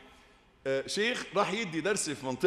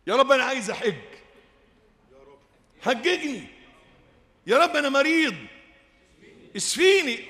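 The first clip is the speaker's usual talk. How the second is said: fervent plea; 7 semitones higher, 5 dB louder than usual. Acted. Voicing direct prayers to God.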